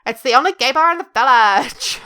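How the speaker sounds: loud, hammed-up Welsh accent; bad Welsh accent. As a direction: attempt a Welsh lilt and miss. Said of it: "still can't do a Welsh accent".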